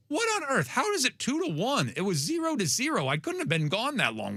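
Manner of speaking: high, startled Aussie-free disbelief at the scoreline; baffled disbelief. Retold or imitated. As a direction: sound genuinely thrown, like you just missed everything. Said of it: "I'm like".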